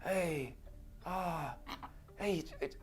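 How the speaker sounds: very soft gravelly murmur; a hushed hero addressing a foe; Batman impression. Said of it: "I think that mine would just be like".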